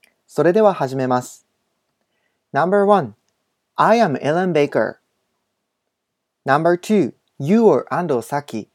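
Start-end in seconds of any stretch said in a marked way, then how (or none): 2.5-7.1 slow, carefully spaced model English reading; お手本の英文音読. Shown as a demonstration. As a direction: read each sentence slowly for learners to repeat. (英語のお手本をゆっくり音読)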